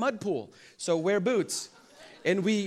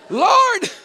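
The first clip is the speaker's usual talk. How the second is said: hammed-up cry to heaven, much higher and louder; mock desperate cry; 14 dB louder than usual. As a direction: yelp it up to the Lord in fake panic. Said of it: "I'm like".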